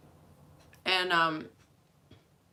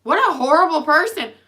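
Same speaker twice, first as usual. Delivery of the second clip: mock judgmental; 8 semitones higher, 11 dB louder than usual. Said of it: "And everybody's like".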